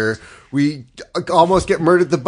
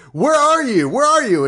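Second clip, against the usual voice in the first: frantic calling; 9 semitones higher than usual. Retold or imitated. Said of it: "you're like".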